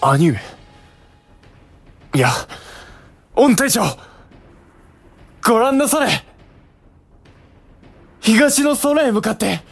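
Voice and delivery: loud, eager calling out; excited shout. Acted. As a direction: call out, pointing to the sky. Brother calls out to general.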